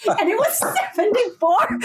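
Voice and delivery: laughing through the words; cracking up while telling it; breaking into laughter at the punchline. Real, not acted. Telling funny story, laughs.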